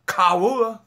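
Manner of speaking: usual speaking voice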